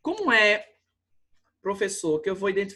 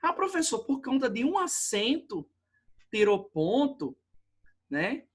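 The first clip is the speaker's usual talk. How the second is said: student's protest, starting much higher; complaining student; 4 semitones higher than usual. Acted. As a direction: whine up high like a student who lost a point. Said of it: "você diz".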